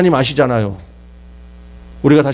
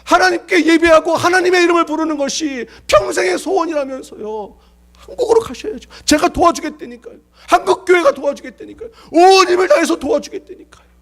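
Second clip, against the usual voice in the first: pleading. Retold or imitated. Pastor pleading with old man.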